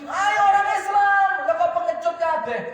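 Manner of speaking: loud, high, stretched-out challenge; taunting shout. Acted. Amr challenging the Muslims to fight, shouting high. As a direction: shout a jeering dare at the whole army. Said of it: "teriak Amr bin Abdi Wud".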